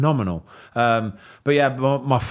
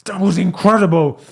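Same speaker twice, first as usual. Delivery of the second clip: higher and louder, overjoyed; retold elated cry; pumped-up owner; 7 semitones higher, 5 dB louder than usual. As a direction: blurt it out buzzing with joy. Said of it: "he is pumped like you wouldn't believe".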